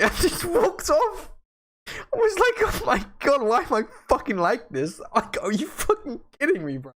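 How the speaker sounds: cringe-laugh, squeaky high; embarrassed laughing. Real, not acted. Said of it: "My nerves".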